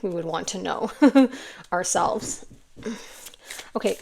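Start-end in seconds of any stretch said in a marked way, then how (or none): 1.0-1.7 1 laugh. Real, not acted. Laugh mid sentence.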